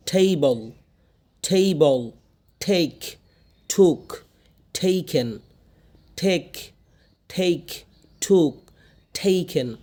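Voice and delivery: every t released with a strong puff; aspirated T words. Shown as a demonstration. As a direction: model each word apart, puffing the T. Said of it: "ঠ করতেছি".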